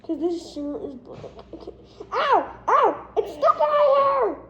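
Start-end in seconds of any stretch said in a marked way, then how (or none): 2.1-4.4 loud yelps of pain; pained yelling. Real, not acted. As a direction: yell out as your hair gets caught. Shirt caught in hair.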